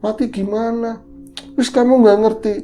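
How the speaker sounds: put-on high, exasperated old woman; voicing the grandma. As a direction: brush him off impatiently. Grandma scolds in story. (nenek kesal bersuara tinggi)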